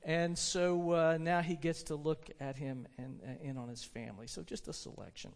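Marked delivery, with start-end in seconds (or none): none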